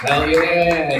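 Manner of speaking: ordinary talk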